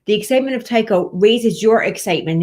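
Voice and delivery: ordinary delivery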